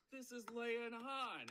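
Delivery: high, drawn-out mock cry of delight; imitated delighted exclamation. Director clapping at two bickering actors, imitated high delighted exclamation.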